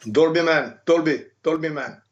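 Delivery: a hint of a German-accented buddy; lightly voiced German trainer greeting his friend. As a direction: call his nickname in a faint German accent. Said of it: "a sliver of Arnold's accent".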